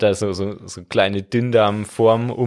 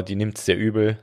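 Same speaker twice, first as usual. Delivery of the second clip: ordinary talk, nothing marked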